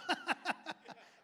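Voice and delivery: a big real laugh, pitched high; hearty real laugh; burst of laughter. Real, not acted. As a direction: laugh out loud at the joke. Laugh after quote.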